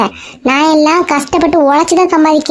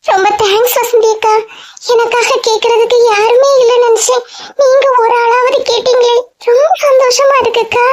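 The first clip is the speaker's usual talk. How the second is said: higher voice with a catch of tears; grateful, almost crying, voice going up; teary thanks; 9 semitones higher than usual. Acted. Geetha thanks Vasanthi akka, tearful and grateful.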